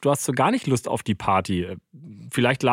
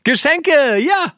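excited; 14 semitones higher, 6 dB louder, much slower than usual. Real, not acted. Delighted repeat of answer.

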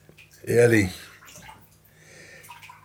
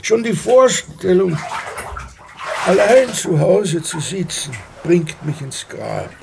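much louder and higher, exasperated; exasperated outburst; 6 semitones higher, 5 dB louder than usual. Acted. Husband to wife about retiring, loud exasperated outburst. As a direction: burst out against the thought of retiring.

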